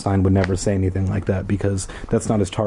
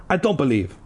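ordinary delivery, nothing marked

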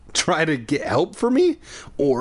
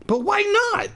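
very high wail. A narrator's reading. Boy wailing at the shopkeeper's refusal. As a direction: wail it like a hurt child. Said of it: "I wailed".

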